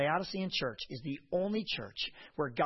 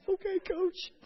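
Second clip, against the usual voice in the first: put-on much higher small girly voice; meek girls replying; 4 dB louder than usual. Players answering their coach, small squeaky girly voice. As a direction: squeak it like a cowed teenage player.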